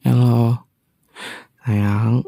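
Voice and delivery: ordinary talk, nothing marked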